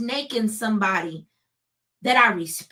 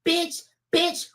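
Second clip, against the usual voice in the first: high retold yelling of bitch; higher mock yell; mock scream; 8 semitones higher, much slower than usual. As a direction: shriek it like the phone fight. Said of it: "we screaming at each other on the phone".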